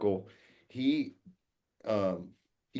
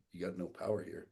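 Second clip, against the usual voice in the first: softer, understated firm reply; calm rebuttal; 2 semitones lower, 7 dB quieter, faster than usual. Retold or imitated. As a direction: quietly deny him any authority. His own calm reply to the yeller.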